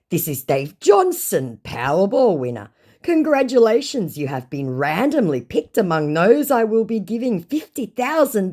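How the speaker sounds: high, hammed-up prize announcement; mock a gushing scammer announcing a jackpot; big swooping congratulatory act. Reads scammer's chat aloud.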